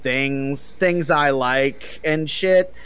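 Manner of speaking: high, slow mock celebrity guest; Hideo Kojima. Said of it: "uh, Hideo Kojima".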